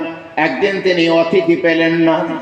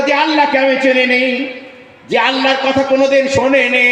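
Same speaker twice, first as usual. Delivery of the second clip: raised, higher ringing exhortation; preaching at full force; 8 semitones higher than usual. Acted. Preacher urging listeners to give to beggars, raised exhortation. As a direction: lift the voice and urge the crowd. Sermon climax.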